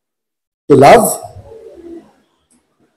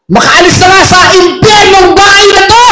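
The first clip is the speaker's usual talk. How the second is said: high angry mock shout; father shouting; 11 semitones higher, 3 dB louder than usual. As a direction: storm out yelling. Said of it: "Biglang sabi ng tatay niya".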